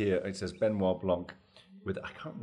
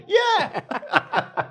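high, loud laughing shout of yes; shrieking yes mid-laugh; excited yelp; 11 dB louder than usual. Real, not acted. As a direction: burst out with a delighted yes. Laughing about saying yes.

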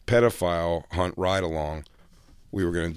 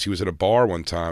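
ordinary talk, nothing marked

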